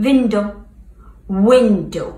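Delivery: Window with round lips, no teeth on lip. Shown as a demonstration. Teacher repeating the rounded-lip W in Window. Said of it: "With lip, okay?".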